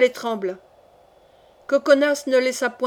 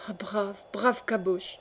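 soft, slow murmur; murmured aside; 3 semitones lower, 7 dB quieter, slower than usual. A narrator's reading. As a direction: murmur it slowly to an accomplice. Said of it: "murmura Coconas". (murmure lent et doux)

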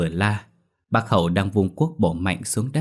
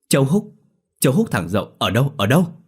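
fast, higher alarmed questions; shocked questions; 5 semitones higher, 4 dB louder, faster than usual. A narrator's reading. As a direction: demand where, in sudden alarm. Farmer shocked by news.

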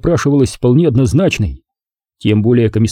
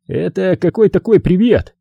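surprised; 7 semitones higher than usual. A narrator's reading. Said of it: "удивился Шурале".

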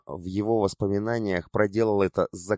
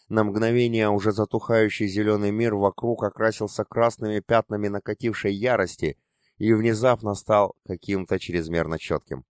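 plain reading voice

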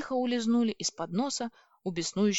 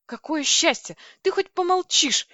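overjoyed, much higher and louder; delighted; 9 semitones higher, 7 dB louder than usual. A narrator's reading. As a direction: cry it out with delight. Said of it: "обрадовалась я".

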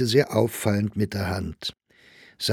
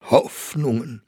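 mocking, incredulous repeat of one word; ironic echo; 3 semitones higher than usual. A narrator's reading. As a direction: echo it back with scorn. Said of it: "las mit ironischer Betonung". (spöttisch nachgesprochen)

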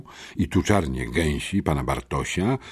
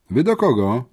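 higher, sharp challenge; sharp question; 7 semitones higher, 4 dB louder than usual. A narrator's reading. Farm girl confronting strangers in the yard, sharp. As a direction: challenge the visitors curtly. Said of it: "zapytała ostro".

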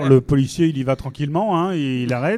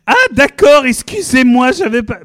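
high, louder flustered blonde; ditzy surprise; 7 semitones higher, 5 dB louder, slower than usual. Acted. Driver apologizing to the policeman, flustered. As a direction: squeak out an apology.

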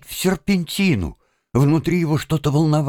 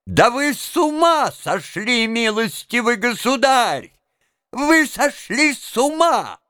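high-pitched outraged cry; enraged; 8 semitones higher than usual. A narrator's reading. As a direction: berate him in a high, indignant voice. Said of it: "закричал конректор вне себя от гнева". (гневный крик)

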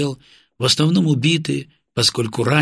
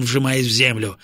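plain reading voice

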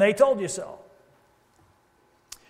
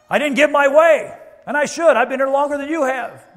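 higher, louder indignant whine; louder, higher entitled complaint from a longtime member; petty whine; 7 semitones higher, 10 dB louder than usual. Pretend. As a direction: play the entitled old-timer. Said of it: "mocking a petty church member".

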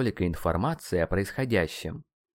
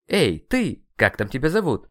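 louder, higher brusque hail; rude, raised-voice summons; calling out; 5 semitones higher, 5 dB louder than usual. Acted. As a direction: call out to get someone's attention. Hailing the dark entity rudely.

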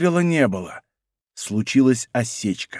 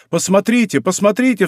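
raised, urgent exclamation; crying out; 6 semitones higher than usual. A narrator's reading. King to Athos as troops desert, crying out. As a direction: cry out higher, urging them to look. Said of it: "вскричал король".

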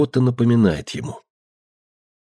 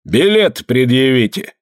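rough voice; 4 semitones higher, slower than usual. A narrator's reading. Ship's mate demands tickets.

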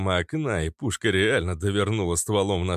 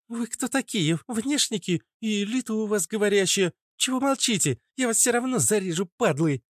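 put-on high-pitched, hostile little girl; hissing feisty girl; 14 semitones higher than usual. Captured girl challenges the strangers, hissing and high. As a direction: spit threats in a high girlish voice. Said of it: "прошипела девчонка".

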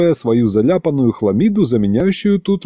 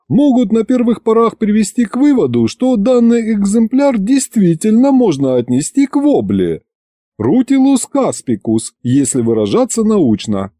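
high-pitched pedantic lecture voice; lecturing scientist; 10 semitones higher than usual. A narrator's reading. Scientist classifies the fish for his listeners, lecturing. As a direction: lecture with learned self-importance, pitched up. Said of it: "начал учёный свою лекцию".

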